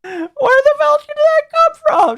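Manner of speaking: high squeal of laughter; laughing through words. Real, not acted. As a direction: laugh it out in disbelief.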